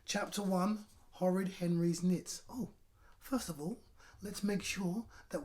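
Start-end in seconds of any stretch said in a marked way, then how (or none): none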